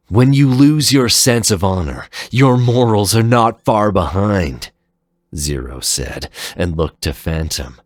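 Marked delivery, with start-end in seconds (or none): 0.1-4.7 louder, higher, reproachful; stern rebuke. Acted. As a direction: scold them with conviction. Zero rebukes the Guardians.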